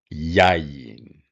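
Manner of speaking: "yayin" with the weight on YA, second syllable light; demonstrating "yayin" stressed YA-yin; stress on first syllable. Shown as a demonstration. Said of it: "your accent is on the first syllable".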